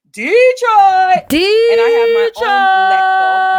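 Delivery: big proud hometown yell; proud shout. Real, not acted. Speaker proudly shouting out his hometown. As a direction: belt out the city name with pride.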